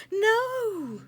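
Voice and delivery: high, loud cry of protest. Acted. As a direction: shout it to defend the caterpillar. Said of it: "Butterfly Fairy cried".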